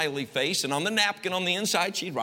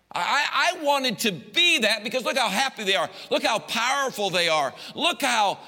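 excited yearning; 6 semitones higher, 3 dB louder than usual. Retold or imitated. Teen self craving worldly life.

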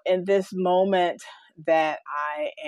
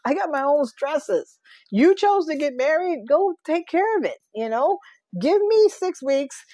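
high, loud exasperated rant at daughter; mock exasperated mother begging for space; exasperated plea; 10 semitones higher, 4 dB louder than usual. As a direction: plead half-laughing, fed up and pitched up. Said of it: "I just, just".

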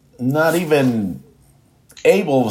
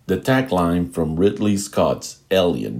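ordinary talk

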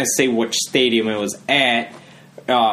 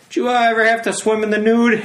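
high-pitched, leading question; mock innocent question; 10 semitones higher, faster than usual. Podcaster baiting older Janesville alumni, mock innocent question. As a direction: pitch it up like a teasing query.

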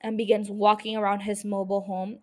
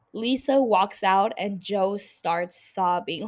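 usual speaking voice

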